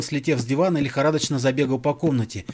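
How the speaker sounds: ordinary delivery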